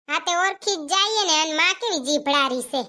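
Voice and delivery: usual speaking voice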